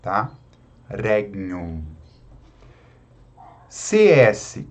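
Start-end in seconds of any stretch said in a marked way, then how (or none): 0.9-1.8 "Regnum" low, as "reg-nyum"; lightly touch the G in "Regnum"; soft G. Shown as a demonstration. Teacher showing their own habit, "Regnum" with soft G. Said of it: "com o G suave".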